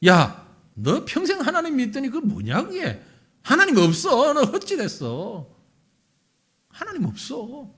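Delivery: loud, high jeer, slowing at the end; mock the believer; jeering taunt. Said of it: "그 말을 들었을 때에". (믿는 사람을 놀리는 크고 높은 야유)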